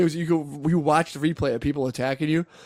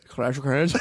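Clans said as "Crans" in a mock Chinese accent; slower than usual. Shown as a demonstration. Answering how the Chinese would say it, mock Chinese accent. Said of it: "how would the Chinese say that".

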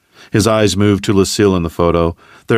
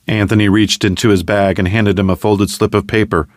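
usual speaking voice

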